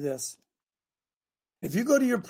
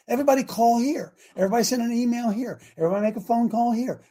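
ordinary delivery

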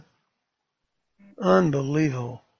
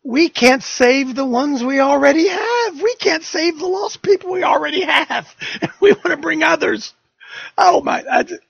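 exasperated outcry; 10 semitones higher, 5 dB louder than usual. Real, not acted.

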